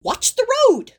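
high, loud panicked warning; alarmed shout. Acted. Angel yelling at Crowley to mind the road, high and loud. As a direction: yelp it in alarm.

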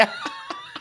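high shrieking laugh; squealing laugh. Real, not acted.

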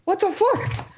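startled high-pitched yelps; real fright, voice jumping way up; screaming at a spider. Real, not acted. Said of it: "What are you, scared?".

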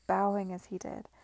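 plain reading voice

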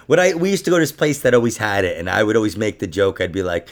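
usual speaking voice

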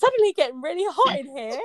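mock flustered. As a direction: fan yourself in jest and stress hot. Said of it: "just trying to catch my breath here".

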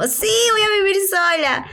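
giddy first take, all thrill; excited. Retold or imitated. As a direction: chirp it like great news. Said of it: "primero yo estaba como".

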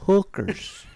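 put-on Mexican accent. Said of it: "decided to sound Mexican".

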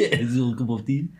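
lightly echoed mock French accent; a hint of silly French accent; weird French accent. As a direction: laughingly copy a bad French accent. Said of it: "she was a weird French accent".

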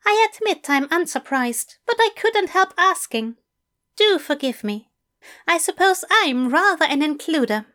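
high, eager English teacher; bright, keen invitation from a fussy gentleman. A narrator's reading. Fell voice again.

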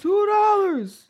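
high, slow, drawn-out two dollars; mock astonishment. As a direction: stretch it out in mock disbelief. Cohost mock shock at price.

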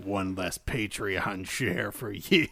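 low, slow, smug villain quip; low slow villain line. Retold or imitated. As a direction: gloat over it, slow. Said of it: "I'm like".

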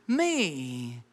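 very slow, firm reassurance; slow it right down and insist gently; God telling believers to trust him, very slow; calm voice of God. Acted. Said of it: "God's like".

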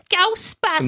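high nasal impression of an actress; nasally. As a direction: pitch it way up through the nose. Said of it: "have that kinda nasally".

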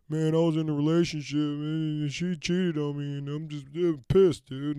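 imitating a self-pitying guy griping about his ex; mock whiny complainer. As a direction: ham up a guy moaning about being cheated on. Mocking a complaining heartbroken guy.

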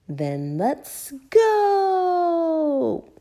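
high shout with a long held last word; Daddy Pig shouting. A narrator's reading. Daddy Pig setting off in the car, shouting. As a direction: launch the trip with a big drawn-out cry. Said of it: "shouted Daddy Pig".

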